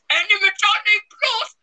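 high, loud mock-triumphant shout; shouted punchline. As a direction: belt out the pun like a big reveal.

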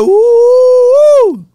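long, high, loud "woo"; hammed-up high whoop; admiring whoop. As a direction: let out a drawn-out wolfish whoop. Imitates reaction to admired woman.

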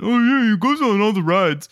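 imitating the dad. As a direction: keep the sister's dad impression going. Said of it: "This is how she imitates Almond".

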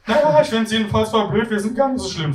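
high-pitched flatmate voice. Acted. Flatmate disagreeing with the others, high character voice. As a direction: lift the voice far above your own.

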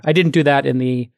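usual speaking voice

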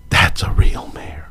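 whispered under the breath, mocking; a hushed, knowing whisper of the quote; sarcastic whisper. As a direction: breathe the words like a sly aside.

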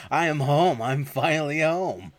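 loud, high triumphant announcement; acted-out elated homecoming; all excited. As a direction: draw it out with joy on arriving. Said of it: "He's all excited".

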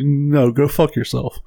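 ordinary talk, nothing marked

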